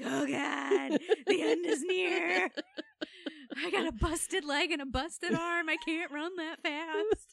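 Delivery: melodramatic. Retold or imitated. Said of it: "I'm out here like".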